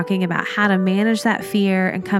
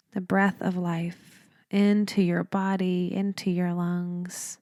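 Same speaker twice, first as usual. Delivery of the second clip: softer, unhurried meditation voice with long pauses; guided meditation; 7 dB quieter than usual. Real, not acted. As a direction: lead them gently through each breath, leaving space between phrases.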